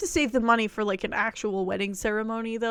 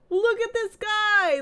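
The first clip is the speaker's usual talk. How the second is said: overwhelmed by cuteness; 10 semitones higher, slower than usual. Real, not acted. Gushing over the dog.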